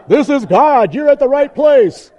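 loud, high mock-divine proclamation; booming voice of God. God welcoming the visitor, booming proclamation. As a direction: thunder it out as God from above. Repeats God joke at full volume.

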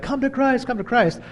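a high, hammed-up preacher's call; mock evangelist. Mocking a pulpit evangelist, a high mock altar call. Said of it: "evangelistic, like".

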